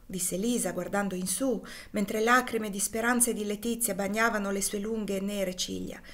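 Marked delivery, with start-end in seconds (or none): none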